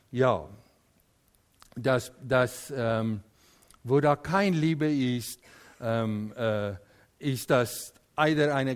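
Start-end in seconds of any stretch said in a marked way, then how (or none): none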